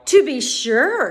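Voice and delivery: the giant answers with pride; proud. A narrator's reading. Small giant answers proudly, slow and loud. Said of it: "he replied proudly".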